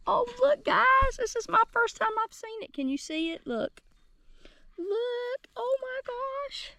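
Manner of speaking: very high squealing delight, dropping to a hushed gasp; thrilled squeal. Real, not acted. Gardener spotting her first pea sprout, high thrilled squeal. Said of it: "I'm so excited".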